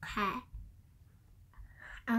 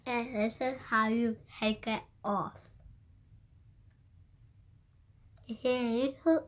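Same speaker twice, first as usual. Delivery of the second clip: palate plate in mouth; slight retainer lisp; speaking with retainer in. Real, not acted. Seen 1: Retainer already in mouth while speaking; removal starts at 3.5.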